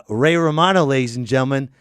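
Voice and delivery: normal talking voice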